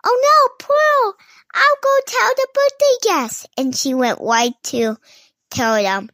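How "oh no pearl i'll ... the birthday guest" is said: unicorn voice. A narrator's reading. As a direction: call it out as an alarmed unicorn, very high. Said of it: "Unicorn said".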